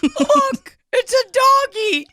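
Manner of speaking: high-pitched mock delight; excited prisoner. Prisoner spotting a doggy, high excited mock delight. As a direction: point it out in a high, thrilled voice. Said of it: "He's like".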